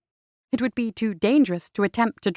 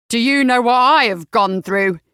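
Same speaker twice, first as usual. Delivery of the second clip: louder aggrieved outcry; the robber crying out in frustration; 5 dB louder than usual. A narrator's reading. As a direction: throw his own grievance back at her. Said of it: "the man cried out".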